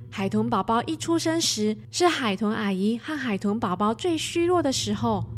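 ordinary delivery, nothing marked

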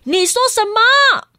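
high, indignant granny demanding; angry grandma. Acted. Grandma scolds the chick, indignant and high. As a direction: bristle up, high and a bit slow. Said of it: "奶奶很生气地说".

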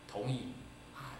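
normal talking voice

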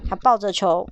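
ordinary delivery

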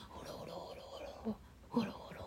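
fast, soft whispered purring. Retold or imitated. Showing the child the cat's purr, whispered fast. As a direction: breathe a quick whispered purr. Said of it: "那也是一种能量吗".

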